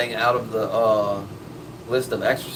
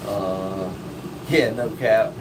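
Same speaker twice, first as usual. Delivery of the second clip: normal talking voice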